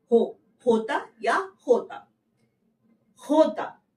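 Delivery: होता with a rasped h from the throat; Spanish jota. Shown as a demonstration. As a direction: slowly drill होता, throat h. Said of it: "जे को बोलते हैं होता".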